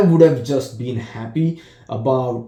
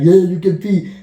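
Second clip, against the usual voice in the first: higher, louder coaxing; mock encouragement; 7 semitones higher, 6 dB louder than usual. Buddy coaxing his friend to pee, mock excited. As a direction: egg him on. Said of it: "and saying".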